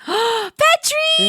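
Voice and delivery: high, gasping squeal of delight. Real, not acted. Host greeting Petri's cat photo, excited squeal. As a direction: squeal the name after an excited gasp.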